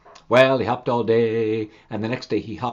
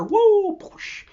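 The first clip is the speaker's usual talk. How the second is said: whoa splash; 5 dB louder than usual. Acted. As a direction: act out the fall. Insect falls in water.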